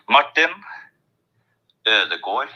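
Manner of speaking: Martin Ødegaard said the Norwegian way, slowly, part by part; Norwegian pronunciation. Shown as a demonstration. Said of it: "let's practice his name together".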